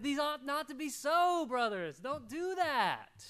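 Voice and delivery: loud, high, pleading preacher cry; preaching at full force. Acted. Pastor pleading with the brothers in a loud high voice. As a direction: press the warning hard on the brothers.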